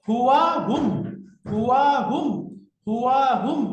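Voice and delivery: emphatic teacher's recitation; loud drill. Shown as a demonstration. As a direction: call the pair out for students to memorize. Teacher drills word pair for memorizing.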